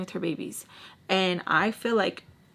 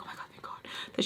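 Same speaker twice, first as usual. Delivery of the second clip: fast relieved whisper; hurried, hushed relief; 12 dB quieter, much faster than usual. Real, not acted. Relieved whisper walking away.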